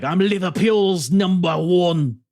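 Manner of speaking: loud, high, drawn-out Scouse boast; wrestling heel's spiel in hammed-up Scouse; Liverpool accent. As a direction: put on a slow, shouted Liverpool accent. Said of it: "I'm working on my Liverpool accent".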